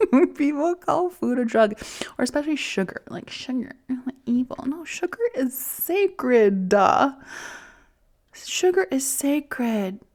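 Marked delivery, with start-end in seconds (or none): 4.7-10.0 emphatic declaration. Real, not acted. Emphatic mock-preaching about sugar.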